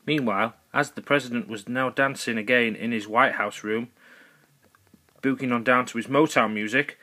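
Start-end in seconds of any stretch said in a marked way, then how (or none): none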